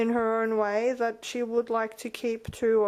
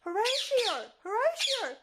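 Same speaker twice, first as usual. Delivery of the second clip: high shrieking call of his name; high squawk call; 11 semitones higher than usual. Real, not acted. Owner screaming back at her squawking bird, high.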